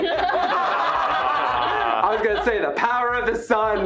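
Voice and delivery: laughing. Real, not acted. Laughing at own joke.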